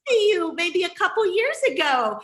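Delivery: high, loud burst of delighted recognition; excited recognition. Real, not acted. Host realises she knows the newcomer, excited recognition. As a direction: exclaim as it dawns on you who this is.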